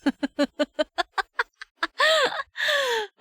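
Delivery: big squealing laugh; giggling burst at the game; high burst of laughter. Real, not acted.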